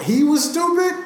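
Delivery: high, loud disbelieving echo; incredulous. Real, not acted. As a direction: throw it back in disbelief. Echoing cohost in disbelief.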